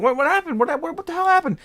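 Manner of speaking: high, loud mock panic; imitated freak-out. Coworker questioning the motionless cat, mock frantic. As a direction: demand answers in a frantic, hammed-up shriek. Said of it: "they start freaking out".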